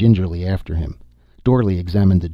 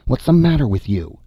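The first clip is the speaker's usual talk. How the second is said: calling out; 7 semitones higher, 4 dB louder than usual. A narrator's reading. Dorley calling to Tance.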